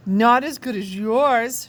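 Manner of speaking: slow, sweet flirty coo.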